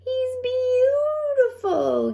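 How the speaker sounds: slow, high, tearful awe; gasped. A narrator's reading. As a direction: breathe it out, moved to tears. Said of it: "gasped Zinnia".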